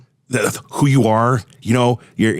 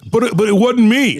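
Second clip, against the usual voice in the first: higher-pitched, stammered mock denial; protesting kid; 11 semitones higher than usual. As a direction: imitate a child insisting on innocence. Son's retold denial.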